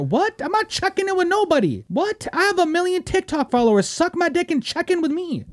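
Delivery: high-pitched, outraged disbelief; indignant comeback. Acted. Acts indignant reply to the threat.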